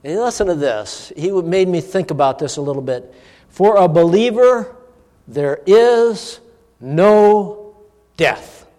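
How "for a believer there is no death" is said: preaching. Retold or imitated. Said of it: "Oh, it was Tony Evans".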